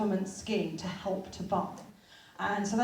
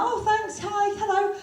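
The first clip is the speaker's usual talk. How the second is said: awkward polite; 10 semitones higher, slower than usual. Retold or imitated. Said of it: "I was like".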